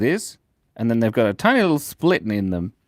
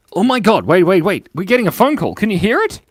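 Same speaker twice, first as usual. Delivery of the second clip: startled excitement; 7 semitones higher, 5 dB louder than usual. Real, not acted. Phone call interrupts stream.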